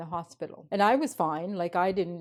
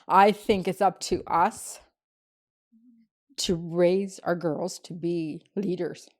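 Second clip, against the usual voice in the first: firm emphasis; 4 dB louder than usual. Real, not acted. Insisting parents must raise leaders.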